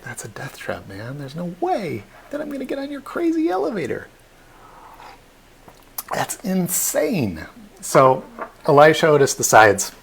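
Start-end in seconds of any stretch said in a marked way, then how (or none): 0.0-7.5 mocking skeptics. Doubtful crowd jeering at Otis's elevator, mocking high voice. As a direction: sneer at the crazy inventor.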